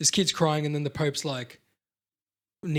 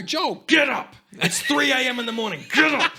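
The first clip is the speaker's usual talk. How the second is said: high, loud hammed-up shout; imitated parent's wake-up shout; mock parent yelling; 9 semitones higher, 5 dB louder than usual. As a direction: yell to rouse a sleeping kid, exaggerated. Acting parent waking the child.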